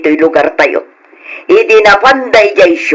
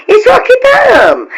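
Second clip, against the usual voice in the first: sudden high cry of pride that settles back; show-off neighbor crowing about her children's help; boastful outburst. Acted. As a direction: brag it out, high at first.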